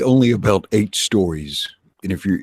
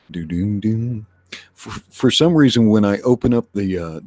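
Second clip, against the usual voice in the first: ordinary talk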